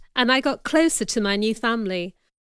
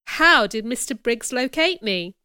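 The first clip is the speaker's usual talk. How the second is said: ordinary delivery, nothing marked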